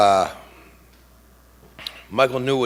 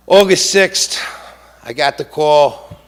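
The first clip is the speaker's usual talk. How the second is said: rising emotion; 4 semitones higher, 7 dB louder, slower than usual. Real, not acted. Father recalling news of son's death.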